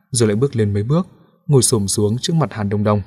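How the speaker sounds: ordinary delivery, nothing marked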